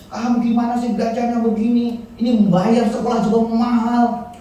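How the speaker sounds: high scolding mother voice. Retold or imitated. Pastor imitating mother scolding son Karis, high scolding. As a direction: play the exasperated mother nagging her son.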